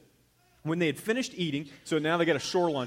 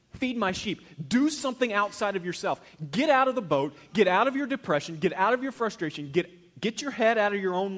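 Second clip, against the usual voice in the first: louder and higher, pressing hard; fervent urging; 6 semitones higher, 6 dB louder than usual. Acted. Jesus urging Peter to look beyond himself, fervently. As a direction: drive each command home.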